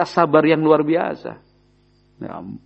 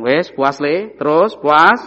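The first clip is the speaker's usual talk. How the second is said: grudging "puas", rising and getting louder to the end; insincere, loud, resentful surrender; sarcastic giving in; 5 dB louder, much slower than usual. Retold or imitated. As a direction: fling the words at the son, half resentful. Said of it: "Intonasinya sudah beda hasil".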